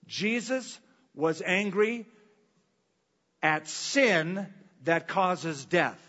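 slow, every word weighed, stress on "sin"; drawn out "Jesus was angry at sin" with "sin" hit hard; slow emphatic. Shown as a demonstration. Preacher asks the church to listen carefully, slow weighty emphasis. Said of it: "Listen carefully".

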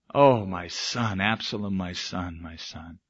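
low, slow lament sinking almost to nothing; grieving cry, fading. Acted. David mourning his son Absalom, fading grief. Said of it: "he cried".

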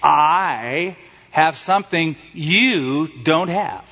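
"I" and "you" drawn out, slow taunt; stress on I and YOU. Retold or imitated. Jesus teasing the disciples' curiosity, stress on "I" and "you". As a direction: lean hard and long on "I" and "you". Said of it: "two words that have the emphasis".

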